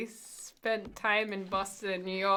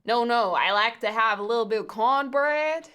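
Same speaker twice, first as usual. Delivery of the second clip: loud, hammed-up American Southern accent; bad Southern accent, pushed hard; mock southern accent; 3 semitones higher, 6 dB louder than usual. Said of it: "try and do a southern accent".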